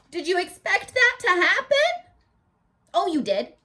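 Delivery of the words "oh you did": usual speaking voice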